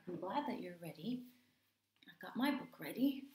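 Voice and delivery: ordinary delivery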